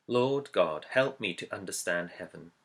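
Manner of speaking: ordinary talk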